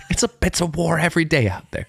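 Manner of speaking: high, fast mock veteran; a hammed-up grizzled buddy from the war; war veteran bluster. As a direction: tell them it's war out there, fast and high. Imitating friend like war veteran.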